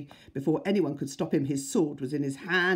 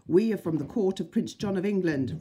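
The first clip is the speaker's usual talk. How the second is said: loud, high proclamation; raised heroic declaration; crying out; 5 semitones higher, 6 dB louder than usual. A narrator's reading. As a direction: call it out boldly to the guards. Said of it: "Robin cried".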